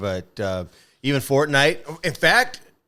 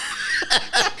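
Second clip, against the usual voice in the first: laughing. Real, not acted. Laughing about plonker.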